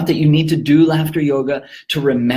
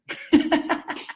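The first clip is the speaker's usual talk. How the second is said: short laugh; 4 dB quieter than usual. Real, not acted. Laughs at dad's remark.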